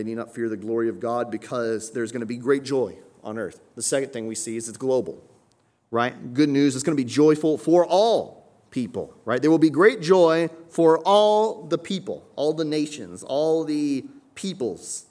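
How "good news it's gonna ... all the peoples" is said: loud, raised preaching voice; fervent preaching. Acted. Pastor preaches joy to the congregation, loud and fervent. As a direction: build up the congregation's excitement.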